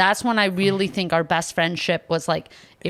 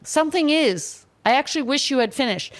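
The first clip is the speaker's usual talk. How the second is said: higher, musing reconsideration; a hint of a sheepish convert; 7 semitones higher than usual. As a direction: lightly voice a colleague changing their mind. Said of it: "people started to come my D Ms".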